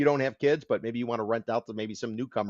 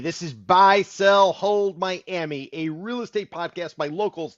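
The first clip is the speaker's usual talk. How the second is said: announcer opener; 4 semitones higher, 7 dB louder, slower than usual. Acted. Show opening announcer greeting.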